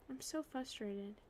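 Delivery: ordinary delivery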